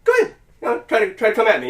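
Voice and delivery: lightly acted dare; goading pep talk. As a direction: egg them on like a trash-talking relative. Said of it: "pep talk".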